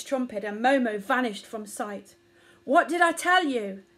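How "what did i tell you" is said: loud, higher angry mother; a mother elephant screams at her son; furious scream. A narrator's reading. Omar screams at her son for nearing a mouse, furious. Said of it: "screamed Omar".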